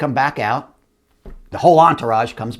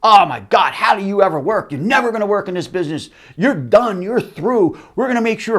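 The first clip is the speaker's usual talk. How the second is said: mock outraged exec; 7 semitones higher than usual. Executives scolding the audio guy, mock outrage. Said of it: "good impressions".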